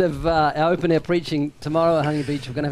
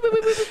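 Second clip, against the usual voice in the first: beeping; faster than usual. Retold or imitated. Imitating a tension meter.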